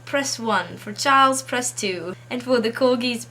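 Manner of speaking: mock phone-menu announcement, punched at each option; bouncy, loud-starting voicemail parody; prank Queen's voicemail. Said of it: "The message says".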